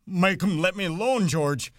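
high, loud, pleading wail; panicked plea for help; terrified cry. A narrator's reading. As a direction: beg your friend to make it stop. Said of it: "he cried".

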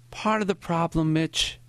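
put-on high old man's voice; Maury, reflective old teacher. A narrator's reading. Old teacher explaining the problem to his student, reflective. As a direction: lift the pitch, an elderly teacher musing.

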